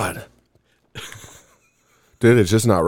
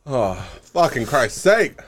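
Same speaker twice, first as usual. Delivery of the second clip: slow, pained words through burning mouth; talking with numb, burning lips; mouth burning from spicy food; 3 semitones lower, 3 dB quieter than usual. Real, not acted. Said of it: "my lips turned numb".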